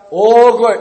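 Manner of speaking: very loud, high, drawn-out shout; full-volume demonstration. Shown as a demonstration. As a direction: show them how loud to be.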